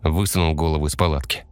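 ordinary delivery, nothing marked

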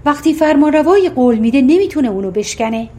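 plain reading voice

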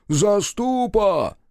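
high, long-stretched searching shout; calling out. A narrator's reading. Frol calling the guardian from the frosty dark, drawn-out holler. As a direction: holler the name across the dark, slowly. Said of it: "донеслось из промороженной темноты".

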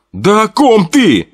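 high, slow, near-yell; almost shouting. Acted. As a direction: nearly shout at him. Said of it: "Уже почти орал на Томаса".

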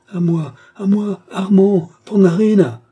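high, loud cries for help; desperate call. A narrator's reading. Marthe struggling in the count's arms, calling desperately. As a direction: call out desperately, drawing out the names. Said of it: "appela Marthe avec désespoir".

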